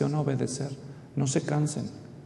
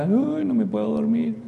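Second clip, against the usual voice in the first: higher, mock-tearful little boy voice; father voicing his small son crying in the dark; whiny scared child; 7 semitones higher than usual. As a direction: imitate a frightened kid who can't sleep. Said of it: "ya estaba llorando acá".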